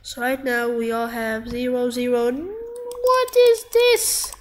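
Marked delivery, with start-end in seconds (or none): 2.3-4.3 high, drawn-out incredulous cry; slow, high-pitched bewilderment; startled disbelief. Real, not acted. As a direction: stretch it out high, baffled by what just appeared. Startled reaction to new game enemies.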